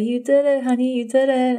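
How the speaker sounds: high, excited praise from the husband; joyful high-pitched encouragement; retold cheering. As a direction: cheer her on like a proud husband. Husband cheering her after birth.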